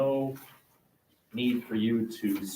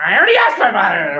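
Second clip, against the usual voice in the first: put-on whiny kid protest; 7 semitones higher, 9 dB louder, faster than usual. Kid answering mom.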